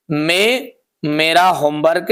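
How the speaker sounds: ordinary talk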